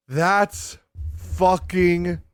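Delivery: extremely drawn-out, slow amazement; amazed reaction. Real, not acted. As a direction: stretch each word out in awe. Reacting to the plot twist.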